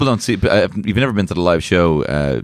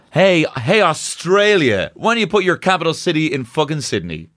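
mock rant; 7 semitones higher than usual.

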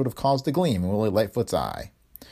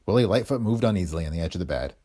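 ordinary delivery

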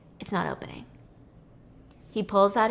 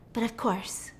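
usual speaking voice

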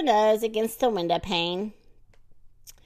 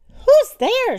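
high, loud startled question; startled call; 13 semitones higher, 8 dB louder than usual. A narrator's reading. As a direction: call out in alarm from bed. Startled sleeper calls out in story.

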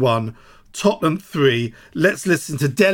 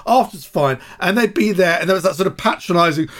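ordinary delivery

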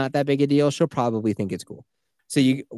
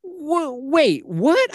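high, stunned outburst; shocked disbelief; 4 dB louder, slower than usual. Retold or imitated. Hearing the toddler went through the cave, high shocked disbelief. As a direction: squeak out disbelief at the news. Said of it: "And I was like".

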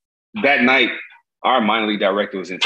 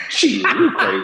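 high disbelief; 11 semitones higher, slower than usual. Retold or imitated. Same reply, pitched high.

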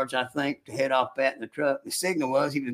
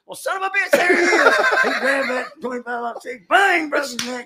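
retold astonished outburst, much higher, with laughter; amazed laughing exclamation; 11 semitones higher, 4 dB louder than usual. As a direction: laugh it out in sudden surprise.

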